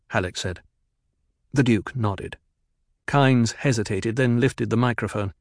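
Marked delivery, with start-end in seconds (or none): none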